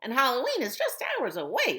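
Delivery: high, loud cackling-witch voice, rushing at the end. Acted. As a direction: play the bossy witch claiming the pumpkin. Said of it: "said the witch".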